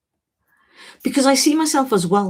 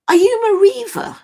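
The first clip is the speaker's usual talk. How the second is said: surprised question; 13 semitones higher, 7 dB louder than usual. Real, not acted. Recognising a viewer from Peterborough.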